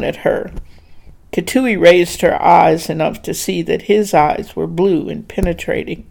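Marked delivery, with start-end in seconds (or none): none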